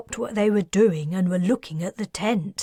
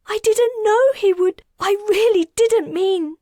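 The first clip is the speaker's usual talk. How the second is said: much higher, tearful, broken voice; sobbing apology; 14 semitones higher, 7 dB louder than usual. A narrator's reading. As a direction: apologise through sobs. Grieving woman speaks to Kez.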